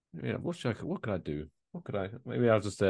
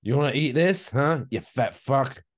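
mock taunt; 4 semitones higher, 4 dB louder, slower than usual. Mock insults to homeless guy.

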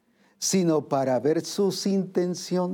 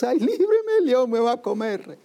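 shrill, very high mock terror; preacher mocking believers who run from the lion, very high and panicky; 8 semitones higher than usual. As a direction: squeal like a coward fleeing the lion.

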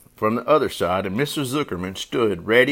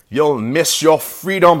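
raised, loud goose voice; honking goose; 5 semitones higher, 6 dB louder than usual. A narrator's reading. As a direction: honk a warning. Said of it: "honked the goose".

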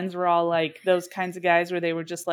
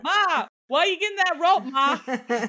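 raised pitch; mock alarm; 8 semitones higher than usual. Pretend scared son.

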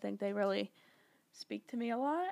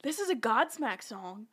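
baffled, higher-pitched mock astonishment; retold disbelief; 4 semitones higher, slower than usual. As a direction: re-enact mild shock, voice lifted higher. Retelling her shocked reaction.

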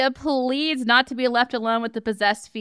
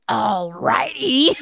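slow, eerie demon drawl; a creepy possessed frat boy; possessed villain voice; 3 dB louder, slower than usual. Acted. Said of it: "she grabs a trident and says".

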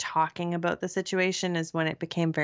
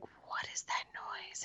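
whispering; 13 dB quieter, slower than usual. Retold or imitated. Retold scared reaction at night.

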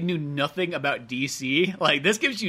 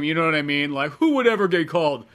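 ordinary delivery

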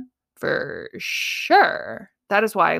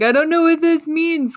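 high, louder, a touch of tearful panic; worried and near tears, pitched up; retold tearful worry; 8 semitones higher, 6 dB louder than usual. Calling her husband, mild tearful panic. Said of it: "I called my husband kinda crying".

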